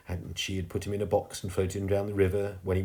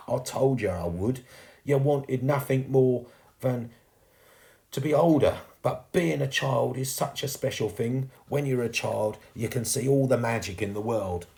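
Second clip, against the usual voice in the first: earnest chimney sweep ghost; 5 semitones higher than usual. Acted. Fading ghost tells girl childhood is special, earnestly. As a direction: swell into wonder, then ease off.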